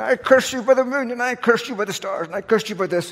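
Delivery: high, mock witchy curse; hammed-up crone laying a curse. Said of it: "This woman's going".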